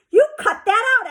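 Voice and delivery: high, loud angry order; girl ordering a friend to stop. A narrator's reading. I told her.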